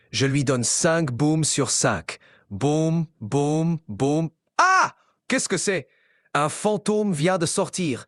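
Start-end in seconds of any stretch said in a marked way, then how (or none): 4.6-4.9 sudden high yelp of fright; startled scream. Acted. Mock shriek at a ghost popping out of a cookie.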